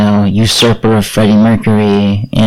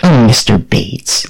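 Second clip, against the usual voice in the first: put-on American accent; slower than usual. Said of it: "I'll say it in an American accent".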